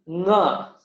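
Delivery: nga sounded through the nose; nasal nga. Shown as a demonstration. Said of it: "This one is a nasal sound".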